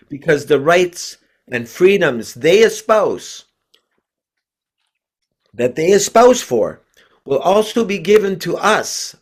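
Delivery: rally the devotees to stand and fight; rousing preaching. Acted. Reads a fiery call to resist.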